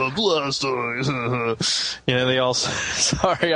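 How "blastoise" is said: slobbery fake sob in a creature voice; mock crying. Pokemon weeping over Ash's death, mock crying. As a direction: blubber the name, badly faked. Making fun of, like, the, probably the saddest part.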